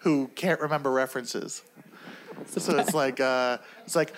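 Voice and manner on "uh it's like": ordinary talk, nothing marked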